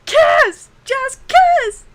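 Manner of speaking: shrieked high and very loud; Amy cheering. Retold or imitated. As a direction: scream at them to kiss. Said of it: "And Amy's like".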